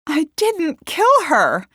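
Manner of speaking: high, loud, slow protest; exclaimed denial. A narrator's reading. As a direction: exclaim innocence in outrage. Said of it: "she exclaimed".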